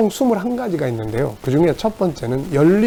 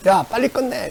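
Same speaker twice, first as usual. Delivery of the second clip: higher-pitched, mild scolding command; mock scolding; 8 semitones higher than usual. Parent telling a dawdling child to finish, mock scolding. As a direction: act out hurrying a child along. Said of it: "아이를 야단치는 것이 아니라".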